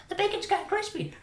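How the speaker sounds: very high falsetto impression of the wife; squeaky wifey accent; put-on wife voice. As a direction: pipe it up like a hammed-up hostess. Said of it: "I don't know why I use my accent for".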